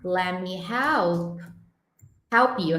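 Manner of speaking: "help" stretched and repeated slowly; slow drilled demo. Shown as a demonstration. Teacher repeats the phrase slowly for students. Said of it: "outro padrão de efeito cotonete".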